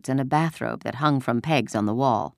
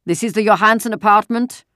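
put-on loud, raised-pitch question in a German accent; thick German accent, projected hard; loud German-accented soldier; 8 semitones higher, 8 dB louder than usual. A narrator's reading. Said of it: "a deep voice asked the question loudly in the terribly accented Danish".

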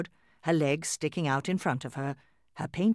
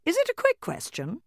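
doll mother voice. A narrator's reading. Mama doll replies.

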